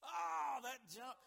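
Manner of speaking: loud high cheer at the jump; sudden loud delight; excited shout. Real, not acted. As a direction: cheer the car over the jump. Car hits the jumper.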